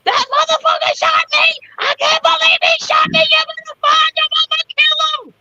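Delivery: high, shouting rage; retelling her shouts after being shot; furious fussing. Retold or imitated. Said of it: "I still was fussing the whole time".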